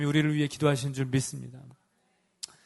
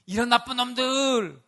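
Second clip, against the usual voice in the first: mock scolding; 6 semitones higher, 4 dB louder, slower than usual. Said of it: "야단치러 오신 게 아니고".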